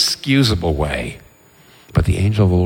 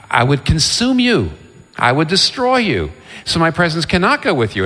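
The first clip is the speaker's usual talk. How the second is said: higher-pitched, mildly acted warning; insistent refusal; 8 semitones higher than usual. God refusing Moses's plea to come along, insistent. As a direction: retell a firm refusal, pitch raised. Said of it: "gets into an argument with God".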